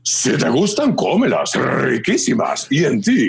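hearty cereal mascot voice in Spanish, riquísimas drawn out slowly; a big friendly tiger selling cereal; Tony the Tiger. Shown as a demonstration. Voice actor recreates his Tigre Tony ad line, mascot voice. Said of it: "lo que yo decía".

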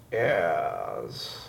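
very low, soft, drawn-out British "yes"; slow British "yes". Shown as a demonstration. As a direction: stretch "yes" like "ears" in British accent. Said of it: "do it really slowly".

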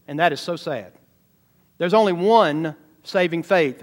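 ordinary talk

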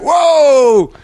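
high, loud, drawn-out whoa; exclaimed whoa. Retold or imitated. Reacting to the drunk guy in the pub, loud whoa. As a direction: let out a big long retold whoa. Recoiling from stumbling drunk, retold.

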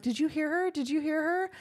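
high, urgent repeated question; anxious asking. Retold or imitated. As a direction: press him for an answer, worried. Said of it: "You're like".

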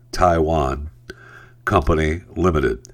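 ordinary talk

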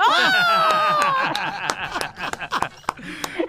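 very high, long whoop of laughter; shocked laughter. Real, not acted. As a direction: shriek with laughter at the scandal. Reacts to a dirty punchline.

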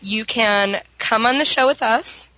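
ordinary delivery

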